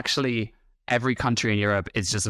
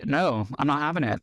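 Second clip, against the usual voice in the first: ordinary delivery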